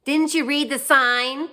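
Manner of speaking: high, loud, slow indignant scold; mock Karen. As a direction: ham up an outraged shopper. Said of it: "she's like".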